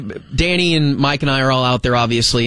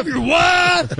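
very high, hollered heckle; mock holler, echoing the heckler; imitated fan yell; 14 semitones higher, slower than usual. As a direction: mimic a Texas fan yelling from the stands. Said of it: "And they are yelling".

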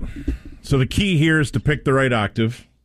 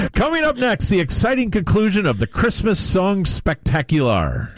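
higher, fast hammed-up promo voice; mock announcer; 4 semitones higher, much faster than usual. Host teasing the next song, mock announcer promo. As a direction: tease it like a TV show trailer.

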